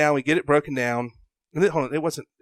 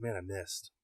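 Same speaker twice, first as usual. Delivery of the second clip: softer, dejected murmur; deflated aside; 4 semitones lower, 15 dB quieter than usual. Retold or imitated. Hunter finding no elk, deflated murmur. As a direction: say it to yourself, crestfallen.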